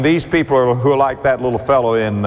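ordinary talk, nothing marked

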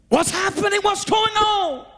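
high, frightened cries, slowing at the end; panicked. Retold or imitated. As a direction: ask in sudden panic. Panicked people left behind.

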